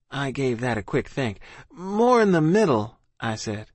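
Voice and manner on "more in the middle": high-pitched, tentative answer; evasive child. A narrator's reading. Child lying to officer, high and tentative. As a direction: venture it up high, like a child guessing. Child guesses under questioning.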